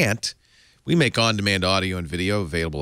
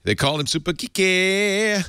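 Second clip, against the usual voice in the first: playful announcing; 9 semitones higher than usual. Pretend. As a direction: stretch out the last word high and playfully. Playful echo of guest's phrase.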